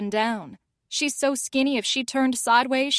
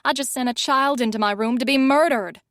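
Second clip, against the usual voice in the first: ordinary delivery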